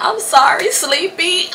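put-on high-pitched, drunken sorry; hammed-up retold apology; pleading apology. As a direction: beg forgiveness in a high voice. Acts her drunken apology.

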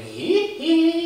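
very high, drawn-out "hee hee" squeal. Acted. Joking about the new mullet look, high pop-star squeal. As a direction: squeal it high, like a pop star's signature cry. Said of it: "I look like [name]".

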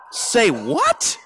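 disbelieving high call; a stunned double take; incredulous. Retold or imitated. Friends reacting to the forgiveness instead of healing, incredulous call.

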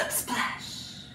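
hushed, voiceless splash; whispered splash. Pretend. Teacher dunking a kitty in paint for children, whispered. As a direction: whisper it like a little sound effect.

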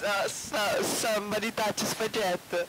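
high, shouted mock Italian; strong Italian accent. Shown as a demonstration. Gamer bringing back the accent bit for friends, loud exaggerated Italian. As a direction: belt it out in stage Italian. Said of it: "a strong Italiano accent".

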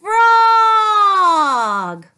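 building to a loud high shout; rising to a big cheer; excited reveal. Real, not acted. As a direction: announce the frog with glee.

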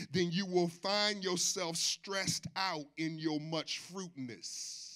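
ordinary talk

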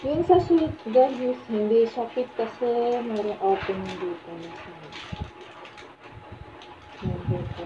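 muffled behind respirator; speaking inside filter mask; through a face mask. Real, not acted. Seen 3: Half-face respirator with pink filters worn over mouth throughout, plus goggles.

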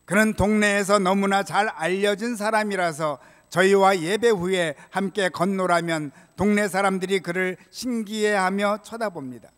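ordinary delivery

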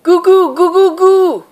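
very high, loud hen call; top of her voice. Acted. My My calling Daisy home at the top of her voice. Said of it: "she called at the top of her voice".

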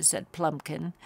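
plain reading voice

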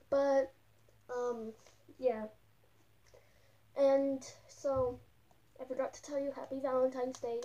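speaking inside a mascot head; muffled through costume head. Real, not acted. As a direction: voice dampened by thick fur mask. Seen 5: Full costume head covers the speaker's face in every frame.

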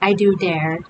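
usual speaking voice

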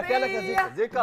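high, loud mom yell. Acted. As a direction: stop the running kid. Mother stopping the running kid.

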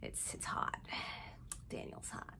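breathless whispered aside. Real, not acted. Confiding to viewers that Daniel is hot, whispered. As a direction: whisper it like a secret crush. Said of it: "aside whispered about Daniel".

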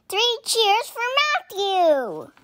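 high, loud, slow cheer; rally the team for Matthew. A narrator's reading.

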